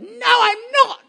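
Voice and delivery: put-on high-pitched old lady squeak; little old lady. Old lady on the front row, to the preacher, in a high-pitched squeak. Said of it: "don't try and do high-pitched voices".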